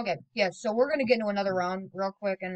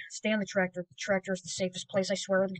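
usual speaking voice

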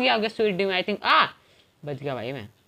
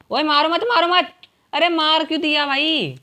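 loud, high-pitched alarmed shout; dismayed yell at a teammate; urgent protest; 7 semitones higher, 5 dB louder than usual. Real, not acted. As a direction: call out to stop them. Calls out to stop a killing.

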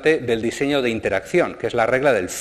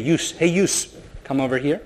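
Northeastern American call; 3 semitones higher, slower than usual. Retold or imitated. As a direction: call the group over like a local. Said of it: "hay partes en Estados Unidos que la gente dice". (llamada regional americana con "Yous")

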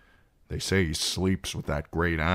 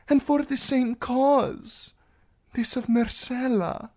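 high, earnest princess voice; pleading princess; 14 semitones higher than usual. Acted. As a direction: plead with the knight for belief. Princess pleads with her lover.

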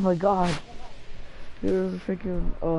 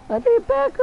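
panicked call for backup; 4 dB louder than usual. Real, not acted. Calling for help in game.

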